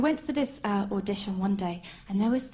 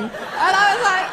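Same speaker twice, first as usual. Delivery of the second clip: ordinary talk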